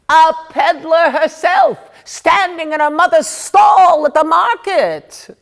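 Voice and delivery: loud, high-pitched scornful cry; indignant outburst. Acted. Gossiping woman exposing a snob's market-stall past, indignant shouting. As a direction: build to an outraged shout.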